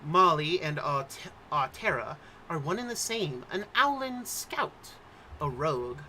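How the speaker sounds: usual speaking voice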